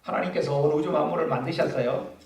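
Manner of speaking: normal talking voice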